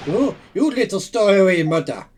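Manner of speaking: loud angry yell; angry shout. Acted. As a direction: bellow it at the stowaway. Sailor yells at stowaway.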